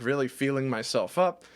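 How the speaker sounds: ordinary talk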